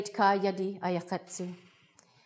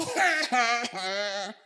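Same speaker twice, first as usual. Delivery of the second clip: long, acted ha-ha laugh; hammed-up guffaw; boy's mocking laugh; 2 semitones higher than usual. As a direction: laugh it up at the fish. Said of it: "he's laughing".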